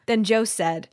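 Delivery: plain reading voice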